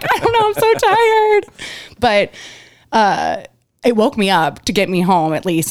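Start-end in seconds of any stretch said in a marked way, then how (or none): 0.0-1.5 high, whining and worn out; whiny tired. Retold or imitated. To her husband at one in the morning, high tired whine. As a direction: whimper it like a wiped-out kid. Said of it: "I'm like".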